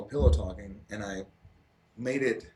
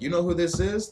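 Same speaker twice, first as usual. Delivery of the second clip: mock coworker; 9 semitones higher, 6 dB louder than usual. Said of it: "people are like".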